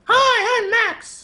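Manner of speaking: put-on very high falsetto girl voice; squeaky put-on female voice; man doing a woman's voice. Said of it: "That's your girl voice?".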